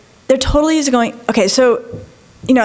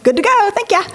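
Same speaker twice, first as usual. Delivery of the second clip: breezy satisfied; 8 semitones higher than usual. Pretend. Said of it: "I would have been like".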